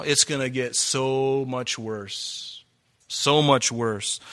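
slow and dark, dragged out; slow ominous warning. Real, not acted. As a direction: let it sink in slowly, like a threat. Ominous warning, repeated slowly.

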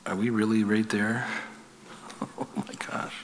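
awed hush; whispered oh my gosh; hushed awe. Real, not acted.

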